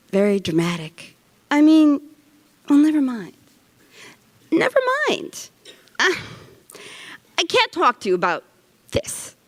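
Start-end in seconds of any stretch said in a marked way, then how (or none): 4.5-9.4 much higher, exasperated dismissal; insistent refusal. Acted. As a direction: shut the subject down, pitch jumping up. Repeats refusal, more insistent.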